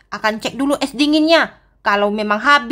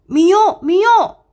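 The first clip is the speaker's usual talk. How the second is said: higher, louder call to Mio from hiding; urgent call; 8 semitones higher, 3 dB louder than usual. Acted. Hiding, calling the girl.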